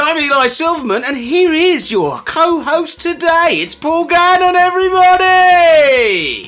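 high, booming show-opening shout; unnecessarily loud. Acted. Host shouting the podcast intro and co-host's name, unnecessarily loud. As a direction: bellow the intro, stretching the final word right out. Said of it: "that was unnecessarily loud".

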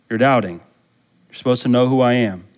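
ordinary talk, nothing marked

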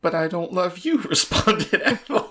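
real laughter breaking through the line; can't keep a straight face while reading; laughing. Real, not acted. Reader cracks up reading.

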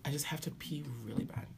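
urgent whisper. Real, not acted. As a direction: whisper it, desperate. Desperate to pee.

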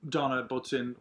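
normal talking voice

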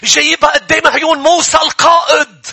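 high and loud scripture preaching; preaching at full force. Acted. Preacher retelling Numbers twenty-five to the church, full force. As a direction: drive the story home to the crowd.

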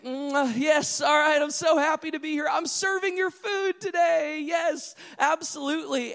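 high, eager waiter voice; mock cheery server. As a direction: play the overly happy restaurant server.

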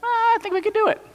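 very high, small hesitant voice; a meek little voice floating an idea; timid Caleb. Pretend. The lone spy Caleb timidly telling the others they could do it, in a tiny high voice. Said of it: "Caleb speaks up, and he's like".

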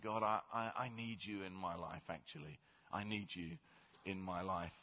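low, soft pleading prayer; humble prayer. Shown as a demonstration. A believer humbly asking God, low and soft. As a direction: pray it quietly and sincerely.

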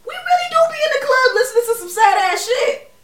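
high, loud laughing rant. Real, not acted.

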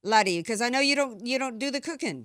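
playful put-on Irish lilt that drifts off course; mock Irish accent. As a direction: tease your co-host in a bad stage Irish accent. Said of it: "Hey, Jamaican".

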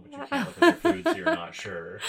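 high, loud laughing words. Real, not acted. Laughing while describing DoorDash.

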